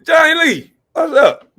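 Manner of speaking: high, loud happy holler; excited shout-out. Real, not acted. Streamer greeting a viewer in chat, loud excited holler. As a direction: call out to him with real delight.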